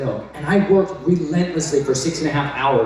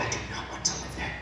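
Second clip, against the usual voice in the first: breathy whisper of dread; 15 dB quieter, faster than usual. Retold or imitated. Recoiling from the farm, whispered. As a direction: whisper it, horrified. Horrified aside.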